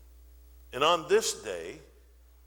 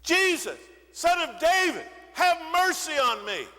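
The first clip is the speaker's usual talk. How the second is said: much higher, louder, pleading scream; desperate cry; 14 semitones higher, 7 dB louder than usual. Acted. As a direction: cry out with everything left. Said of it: "the more desperate his cries become".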